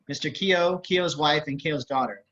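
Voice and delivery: usual speaking voice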